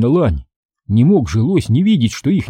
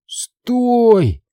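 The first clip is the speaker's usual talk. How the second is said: high, strained cry to stop; choked rasp; 11 semitones higher than usual. A narrator's reading. Boy being jumped on by moose, choked rasp. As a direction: gasp it out under a crushing weight. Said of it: "задыхаясь, прохрипел я".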